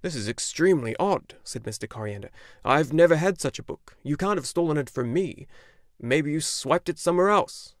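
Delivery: a lightly voiced gruff old bookseller. A narrator's reading. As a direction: speak as the old shopkeeper.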